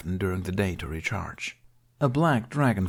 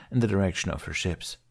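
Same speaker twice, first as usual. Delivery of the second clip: ordinary delivery, nothing marked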